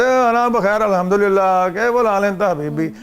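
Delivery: hammed-up Pakistani accent on the Arabic greeting; Pakistani-style Arabic. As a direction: imitate a Pakistani in Dubai speaking Arabic his own way. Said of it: "اپنے Pakistani style میں کہتے ہیں".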